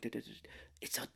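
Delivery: whispered dread. Retold or imitated. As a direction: whisper it like a dawning realization. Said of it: "I'm like".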